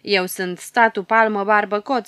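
the little bearded ruler boasting his name; boastful. Acted. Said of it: "adaugă cu îngânfare".

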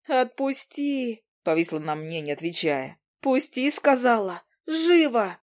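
put-on high, sharp young woman's orders; Izi's commands. Izi ordering Demian to let go, high and sharp. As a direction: order him to drop the man.

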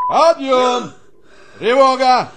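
loud, high wake-up yell; barked alarm call. Acted. Colonel yelling reveille at the sleeping private. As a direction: shout the soldiers awake.

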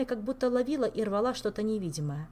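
plain reading voice

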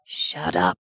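low groaned hush. A narrator's reading. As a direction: groan it under your breath. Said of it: "he groaned".

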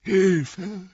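high desperate cry; cry for help. Acted. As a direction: scream for help. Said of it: "der sich wie Hilfe anhörte".

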